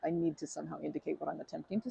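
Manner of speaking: ordinary delivery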